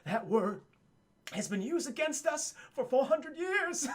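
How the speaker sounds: slow, voice climbing into a cry; hammed-up weepy solemnity; mock tearful lament. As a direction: build slowly toward a high, choked finish. Actor's emotional speech while embracing.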